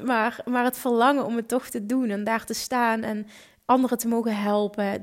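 ordinary talk, nothing marked

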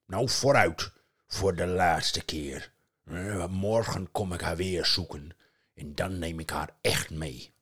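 loud troll threat. Acted. Troll promising to take Asta, loud. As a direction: boast like a big troll.

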